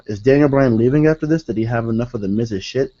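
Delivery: same gasp, at an easier pace; slowed down. Pretend. The fan's shocked question, now said at normal speed. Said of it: "let me slow down".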